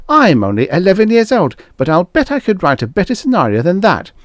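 put-on cheeky child letter-writer; bratty eleven-year-old. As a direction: sneer like a know-it-all kid.

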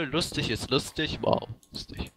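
breathy whispered "wow, lustig"; whispered irony. Acted. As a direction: whisper it, mock amazed. Ironisch über ein Wort. (ironisch geflüstert)

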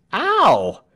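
high, loud yelp of pain; sharp high "ow"; cry of pain. A narrator's reading. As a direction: yelp as if hit on the head. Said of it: "cried Tell".

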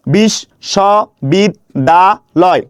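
বিশ্ববিদ্যালয় split into five slow beats, a short pause after each; syllable by syllable. Shown as a demonstration. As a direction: sound out বিশ্ববিদ্যালয় one syllable at a time. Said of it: "কিভাবে উচ্চারণ করি একটু দেখুন তো". (বিশ্ববিদ্যালয় থেমে থেমে উচ্চারণ)

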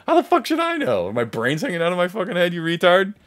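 high-pitched, indignant outburst; acted-out exasperated rant at the bystanders; mad at them. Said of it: "I'm, I'm mad at them".

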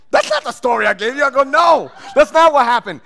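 indignant protest. Retold or imitated. Suspect protesting to detectives, loud and indignant. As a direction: insist it's wrong, raising the voice.

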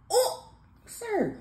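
loud, high-pitched yelp of protest; startled protest. Real, not acted. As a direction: react as if overpowered, voice shooting up. Reacts to his hard flex.

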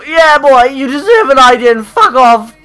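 high, loud fake fury; hammed-up taunt at his game lookalike; mock angry rant. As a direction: pick a fight you don't mean.

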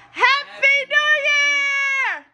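high joyful shout; excited shout. Real, not acted. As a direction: cheer it out at the top. Ball drops, celebration.

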